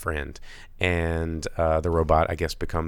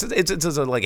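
ordinary talk

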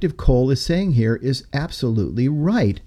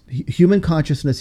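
ordinary delivery

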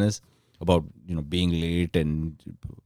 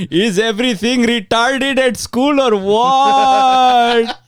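high, loud, slow surfer dude voice; surfer dude dad; 8 dB louder, much slower than usual. Retold or imitated. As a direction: play a dad trying to sound cool. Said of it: "would have come up to me and be like".

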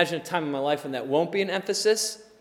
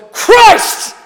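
much higher and louder burst; exultant shout; 13 dB louder than usual. Pretend. Holy Spirit bursting out of a believer, exultant shout. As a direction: let it explode out of you.

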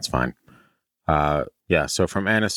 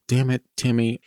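mock exasperated mom; 6 semitones higher than usual. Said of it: "his mom's like".

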